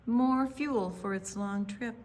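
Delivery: ordinary delivery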